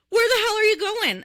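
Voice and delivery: high, loud mock interrogation; demanding cop. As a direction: imitate an exasperated cop demanding answers. Retelling cop's demanding question.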